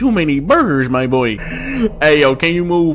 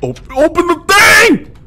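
high, loud panicked yell; panicked shout; 12 semitones higher, 6 dB louder than usual. Real, not acted. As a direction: scream it in panic. Being chased, panicking.

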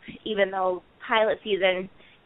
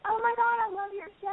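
gushing fan; 3 dB quieter, faster than usual. Retold or imitated. Fan gushing over her show.

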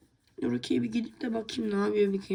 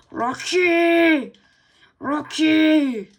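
loud, higher calling shout; loud and higher, searching for Rocky; 8 semitones higher, 12 dB louder, much slower than usual. Real, not acted. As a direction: call Rocky from far off. Calling for missing dog.

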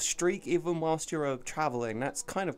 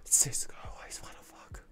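breathy voiced-for-pet whisper; 3 dB quieter than usual. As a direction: hush it like a secret.

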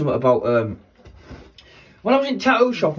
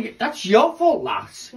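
ordinary delivery

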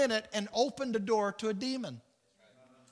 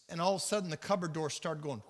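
normal talking voice

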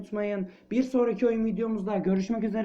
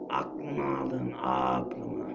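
a deep, dragging parody of his own line; mock deep voice; 5 dB quieter, much slower than usual. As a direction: drop the voice very low and repeat it mockingly. Repeats own line mockingly. (alaycı, çok kalın bir sesle)

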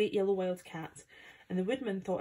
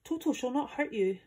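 high, raised calling voice; urgent but reassuring call, pitched up; 7 semitones higher, 3 dB louder than usual. A narrator's reading. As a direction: call after the fleeing mice. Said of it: "while he called to the mice".

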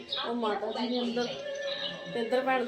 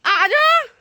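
calling out loud; 13 semitones higher, 10 dB louder, much slower than usual. Real, not acted. Calling out in fear.

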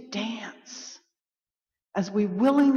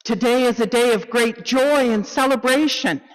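loud, higher, celebratory call; joyful proclamation; 5 semitones higher, 7 dB louder than usual. Acted. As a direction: ring it out with great joy. Call to worship, joy against sorrow.

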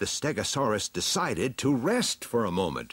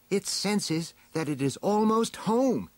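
high-pitched heroine voice; high heroine sharing a discovery; storybook heroine; 12 semitones higher than usual. A narrator's reading. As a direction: report it in a high character voice. Heroine's line in story dialogue.

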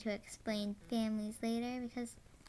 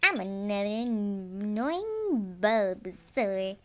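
slow, loud character intro; slow drawn-out; 2 semitones lower, 6 dB louder, much slower than usual. Pretend. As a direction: drag it out slowly.

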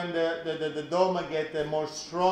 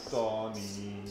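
ordinary talk, nothing marked

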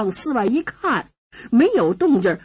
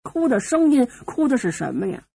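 plain reading voice

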